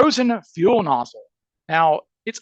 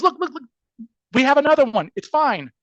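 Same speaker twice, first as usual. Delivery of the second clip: high, hurried placating in imitation; retold frantic reassurance; 8 semitones higher than usual. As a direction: placate someone urgently, pitch way up.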